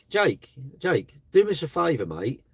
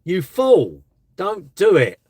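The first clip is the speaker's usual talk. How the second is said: higher, louder, slow scold; mock-scolding a troll, raised voice; exasperated scolding; 6 semitones higher, 6 dB louder, much slower than usual. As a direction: tell the fool off, pitched up. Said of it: "I'm like".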